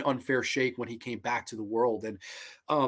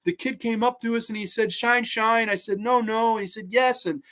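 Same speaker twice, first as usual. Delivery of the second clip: higher, a touch of tearful strain; mock crying; 7 semitones higher, 5 dB louder than usual. Fan retelling Rambo's war story, lightly tearful. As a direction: tell it through held-back tears. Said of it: "When he's crying and he's telling the story".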